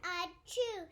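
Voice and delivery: sneeze. Acted. Child's sneeze in story.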